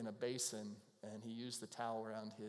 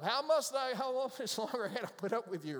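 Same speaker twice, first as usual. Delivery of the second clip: exasperated Jesus; 9 semitones higher, 5 dB louder than usual. Retold or imitated.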